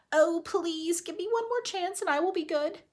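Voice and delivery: high, mock-pleading squirrel voice. Teacher voicing a squirrel pleading for its life, high. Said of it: "He did not think".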